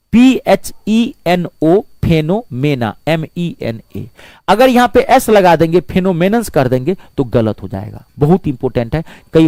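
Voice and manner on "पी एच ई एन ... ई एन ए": slow, each letter of पी एच ई एन ओ एम ई एन ए separate; spelled out slowly. Shown as a demonstration. Teacher spelling phenomena slowly for the class. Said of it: "plural होता है phenomena".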